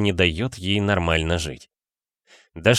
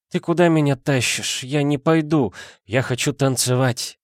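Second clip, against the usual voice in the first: put-on drunk woman; 6 semitones higher than usual. A narrator's reading. Drunk Dasha refusing.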